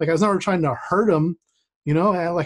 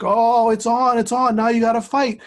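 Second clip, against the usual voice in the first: higher, hyped-up mock chant; friends egging on; 6 semitones higher, 3 dB louder than usual. Friends urge the boys to fight, hyped and higher. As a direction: imitate the crowd goading a fight.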